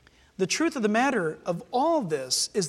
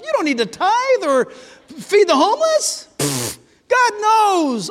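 scoffing tempter; 2 semitones higher, 6 dB louder than usual. Acted. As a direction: talk them out of it with loud mock disbelief.

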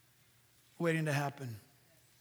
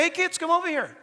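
put-on father calling kids; 8 semitones higher, 5 dB louder than usual. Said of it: "take up his Bible and say".

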